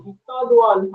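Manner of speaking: ordinary delivery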